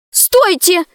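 high-pitched, urgent shout without much extra volume; alarmed cry. A narrator's reading. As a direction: cry out to stop them. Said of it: "закричала Алиса". (встревоженный крик)

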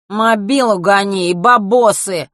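loud, slow nasal thug. Acted. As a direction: demand it through the nose. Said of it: "перевёл гнусавый голос".

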